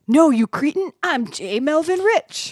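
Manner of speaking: indignant rich man, pitched high; J Melvin Rich. Acted. Banker in a bum's body correcting Mark, indignant rich-guy voice. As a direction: announce yourself loftily, drawing out the name. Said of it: "a Richie Rich guy accent".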